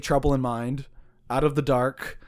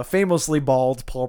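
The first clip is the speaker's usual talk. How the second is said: usual speaking voice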